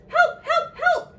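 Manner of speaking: high, loud storybook cries for help; panicked cry. A narrator's reading. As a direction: call out in a hammed-up panic. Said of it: "cried the ants".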